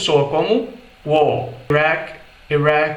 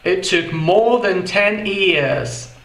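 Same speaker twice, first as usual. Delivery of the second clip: slow British "more" with no R; British English, no R in "more"; British accent; much slower than usual. Shown as a demonstration. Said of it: "Em inglês britânico". (inglês britânico lento, sem R)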